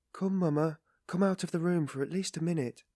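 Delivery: usual speaking voice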